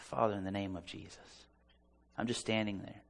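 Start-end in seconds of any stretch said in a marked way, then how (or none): 0.0-1.5 quiet prayer. Retold or imitated. Retold prayer over the woman.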